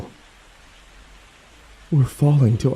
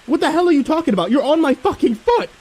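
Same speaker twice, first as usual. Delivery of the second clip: frantic furious snarl, much higher. Acted. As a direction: spit it in his face in disbelief.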